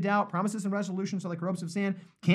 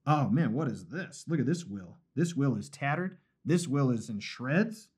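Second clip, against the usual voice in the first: mock dismay; 5 semitones lower, 5 dB quieter than usual. Preacher imitates a God dismayed by a tattered will, mock dismay. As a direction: lightly act out a disappointed inspection. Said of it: "He's not like".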